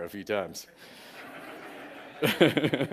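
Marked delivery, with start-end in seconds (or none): 1.1-2.2 quiet unvoiced chuckle; a silent laugh at his own joke; soft breathy laugh. Real, not acted. Laughing at own childhood story. 2.2-2.9 laughing. Real, not acted. Laughing at own childhood story.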